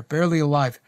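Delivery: ordinary delivery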